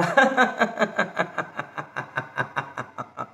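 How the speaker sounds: long rhythmic laugh, fading away; released laughter. Shown as a demonstration. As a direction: let the breath out as a long staccato laugh. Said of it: "आणि सोडा". (श्वास सोडत लांब हास्य)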